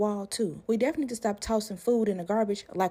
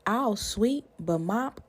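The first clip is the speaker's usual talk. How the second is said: ordinary talk, nothing marked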